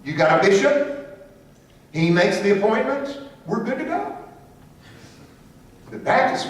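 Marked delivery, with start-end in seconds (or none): 0.1-4.2 pitched high, a breezy mock Methodist. Teacher mimics Methodists settling the question, breezy and high. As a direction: lightly play the satisfied Methodist, rising. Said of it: "The Methodists would say".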